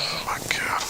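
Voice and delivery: whispered aside of despair; exasperated whisper. Real, not acted. Host despairing at co-host's Todd tangent, exasperated whisper.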